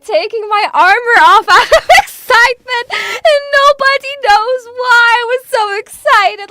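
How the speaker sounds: high, fast, loud excited gush; excited squeal. Real, not acted. Excited rant after being muted.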